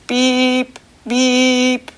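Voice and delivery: short loud beeping honks; beep-beep horn noise; car horn beep. Shown as a demonstration. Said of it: "It's the car horn".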